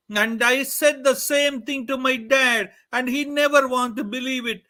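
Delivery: loud, high anguished crying out; a boy wailing his hurt to the god; punished boy cries out to Krishna; sad plea to Krishna. Acted. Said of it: "Venkatanatha was so sad".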